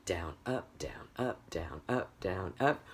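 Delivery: soft, even "down, up" alternation; foot-tap rhythm. Shown as a demonstration. Teacher showing downbeat and upbeat, soft metronomic chant. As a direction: pulse "down, up" like a tapping foot. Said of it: "when you're tapping your foot".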